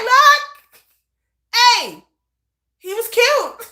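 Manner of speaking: high, loud brush-off; shameless excuse. Retold or imitated. Mother excusing herself to her son, high and loud. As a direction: shrug it off brightly. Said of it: "I'd be like".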